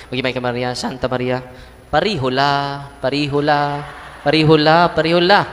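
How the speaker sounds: mock mumbled prayer. Mocking mumbled hurried prayer.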